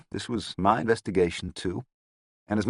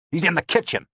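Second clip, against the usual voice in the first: sneering higher snarl; 11 semitones higher, 4 dB louder than usual. A narrator's reading. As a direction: snarl it with contempt. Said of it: "Lavelle snarled".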